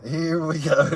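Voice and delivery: laughing. Real, not acted. Chat votes to tell it.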